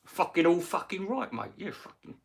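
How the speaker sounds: a hint of a rowdy boxer greeting a mate; Nigel Benn impression. Retelling Nigel Benn greeting him, mild mock boxer voice. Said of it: "He had Nigel Benn".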